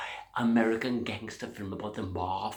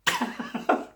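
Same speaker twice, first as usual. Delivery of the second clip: high laugh at own joke; pleased comic laugh; 10 semitones higher than usual. Real, not acted. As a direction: cackle at your own punchline. Laughs at his own punchline.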